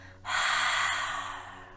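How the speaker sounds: slow, airy demonstration exhale through the mouth; audible cleansing out-breath; long exhale sigh. Shown as a demonstration.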